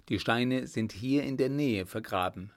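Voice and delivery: usual speaking voice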